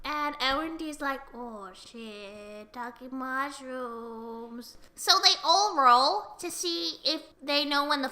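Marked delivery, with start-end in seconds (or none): 1.3-4.8 low, slow, hushed reaction; hushed mock dismay. Elrond spots the talking mushrooms, hushed mock dismay. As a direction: say it low and drawn out, under your breath.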